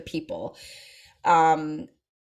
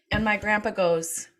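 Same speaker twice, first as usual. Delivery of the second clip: ordinary talk, nothing marked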